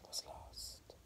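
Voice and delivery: barely voiced, dying away; words drowned out by thunder; fading whisper. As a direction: let it vanish. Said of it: "voice fades to nothing".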